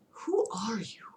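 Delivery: scared whisper; trembling fear. Acted. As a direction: ask in frightened whispers. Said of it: "still trembling".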